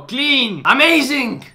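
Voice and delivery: high, loud excited praise; excited screaming. Real, not acted. Praising a clean double back, excited shouting. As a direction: cheer each word excitedly. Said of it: "Why am I screaming?".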